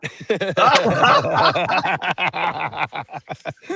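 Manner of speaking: long burst of hearty laughter; a long, rolling laugh; real laughter. Real, not acted. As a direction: laugh hard at your own joke. Laughing at own repeated greeting act.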